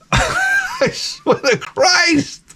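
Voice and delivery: very high, drawn-out squeal of disbelief; slow squealed oath, comic exasperation; exasperated swearing. Real, not acted. Exasperated after dog attacks him.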